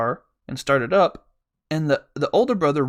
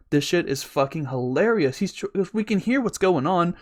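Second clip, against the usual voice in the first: usual speaking voice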